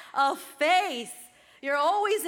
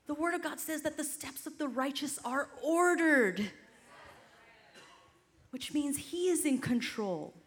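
ordinary talk

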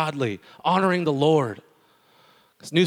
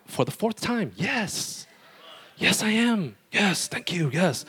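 exasperated; 7 semitones higher, much slower than usual. Retold or imitated. Repeated baptism question.

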